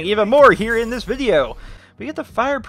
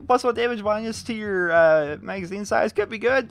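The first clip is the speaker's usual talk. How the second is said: usual speaking voice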